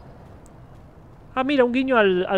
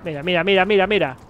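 loud repeated calls; urgent, louder calling out; excited calling; 7 dB louder than usual. Real, not acted. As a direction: call out to get attention. Calling excitedly to cat game.

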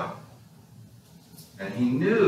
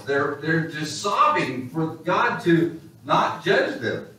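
ordinary talk

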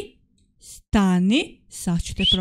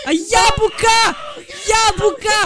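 triumphant shout; 10 semitones higher than usual. Real, not acted. Seeker spots disguised apple.

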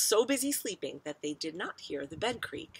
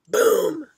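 loud, higher-pitched "boom"; booming crash; 12 semitones higher, 13 dB louder than usual. A narrator's reading. Floor gives way under the bed, loud boom. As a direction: burst out with a big boom. Story sound effect read aloud.

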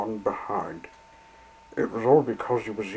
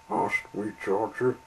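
ordinary delivery

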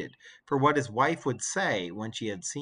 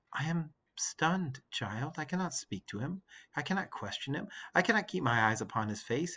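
Penelope, weary; 3 semitones higher, 4 dB quieter than usual. Acted. Penelope answers her son.

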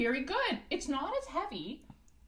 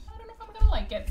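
high, loud, rushed jitters; nervous excitement; 11 semitones higher, 7 dB louder, much faster than usual. Real, not acted. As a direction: blurt it out before the first sip. Nervous about first eggnog.

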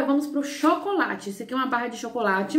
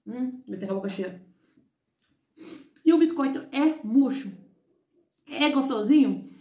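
chewing between words; talking while chewing. Real, not acted. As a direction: mouth partly full. Seen 0: hand to mouth at 2.8, chewing mouth movements through 9.2.

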